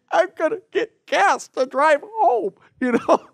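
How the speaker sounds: high, choked-up crying voice imitating the grateful pastor; mock crying. Pastor imitating himself crying to the church about milk, high and tearful. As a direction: play it through happy tears, voice cracking up high. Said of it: "that level of crying".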